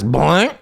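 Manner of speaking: high cartoon boing, rising; a springy sound effect, mocked; bouncy springy boingy voice.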